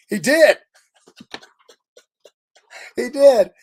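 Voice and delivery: loud and high with joy; thrilled shout; excited. Real, not acted. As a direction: burst out delighted.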